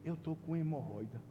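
low, hushed retold admission; embarrassed confession. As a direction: confide it softly, as a delicate secret. Confiding an embarrassing ailment.

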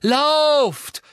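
high, loud urgent scream. Acted. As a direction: scream at them to flee. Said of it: "schrie Harry".

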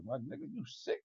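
normal talking voice

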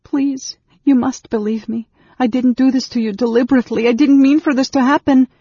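high, loud, hurried plea; desperate pleading. A narrator's reading. Anne Beryl begging Raistlin to believe her, desperate and high. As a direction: plead frantically, rushing and rising in volume. Gripped woman pleads with mage.

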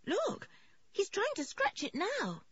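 put-on very high worried child's voice; anxious alarm. A narrator's reading. George warning the vet about the scratching, anxious high voice. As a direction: point it out urgently in a high voice. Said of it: "asked George anxiously".

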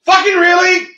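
high, loud, fed-up shout; exasperated outburst. Retold or imitated. Complaining about coworker moving pens, exasperated yell. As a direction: blow up at the misplaced pen. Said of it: "and I'm like".

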